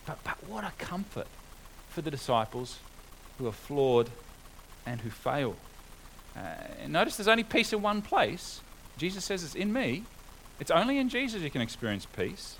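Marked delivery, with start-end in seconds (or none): none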